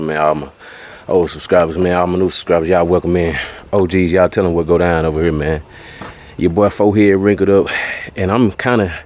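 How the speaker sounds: ordinary delivery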